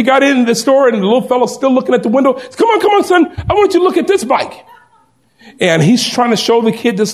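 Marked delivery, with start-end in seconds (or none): 2.6-4.6 very high, eager wheedling; coaxing father. Retold or imitated. Father coaxing his son toward the cheap bike, high and eager. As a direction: sweet-talk the kid away.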